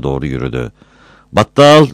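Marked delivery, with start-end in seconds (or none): 1.3-1.9 much higher, loud call after him; loud call of Battal. Acted. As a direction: call his name as he leaves. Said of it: "diye seslendi kadın arkasından".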